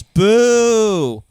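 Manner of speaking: heckler boo. Retold or imitated. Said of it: "and he goes".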